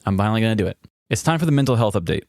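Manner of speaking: normal talking voice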